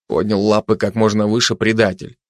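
ordinary delivery

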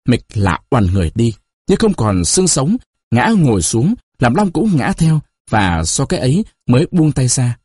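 ordinary delivery

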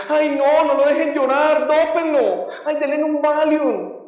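mock alarm, pitched right up; alarmed mourner. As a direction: imitate a panicky relative calling for help. Imitates alarmed mourners at wakes.

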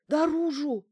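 high, loud panicked answer; near hysterics. A narrator's reading. Bankina answering which way the door opens, near hysterics. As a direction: blurt it out high and frightened. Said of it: "она близка к истерике".

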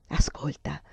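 low ominous whisper; eerie low whisper. Acted. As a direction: hush her and make her listen. Ominous warning to her sister.

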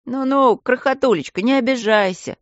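high, fussy coaxing; worried soothing. A narrator's reading. Aristarkh calming the offended princess, worried soothing. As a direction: placate her anxiously. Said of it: "забеспокоился Аристарх".

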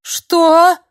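плаксивый визгливый возглас, намного выше и громче; пискнуть обиженно, как ребёнок; плаксиво. Acted. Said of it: "плаксиво протянул он". (старик плаксиво тянет «Что?»)

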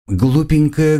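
high teasing lilt; mock the word lightly; mocking sing-song. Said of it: "продолжила его слова девушка".

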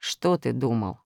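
low, soft stern question; sterner. A narrator's reading. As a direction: press him, sterner still. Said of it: "ещё суровее спросил Нуль". (суровый тихий вопрос)

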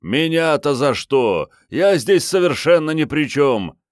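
high, loud wailing protest; howling protest. Acted. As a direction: howl it out in panic. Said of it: "Взвыл Алексей Валентинович".

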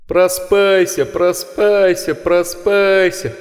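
mock the boy's words like beasts; second inhuman chorus. A narrator's reading. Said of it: "подключился второй хор".

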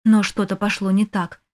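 ordinary delivery, nothing marked

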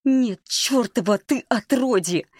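slow, high-pitched furious curse; explode, stretching the curse out; lost temper. A narrator's reading. Маришка accused of lying by Володя, losing her temper. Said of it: "окончательно вспылила Маришка".

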